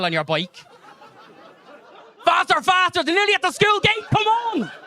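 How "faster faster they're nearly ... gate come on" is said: high, loud, panicky cheering-on; frantic urging shout. Acted. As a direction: urge them on as if racing against time. Acts out frantic chase on bike.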